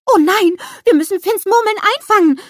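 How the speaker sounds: high, loud alarmed snowman cry; startled cartoon snowman shouting; Olaf, startled. A narrator's reading. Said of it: "ruft Olaf erschrocken".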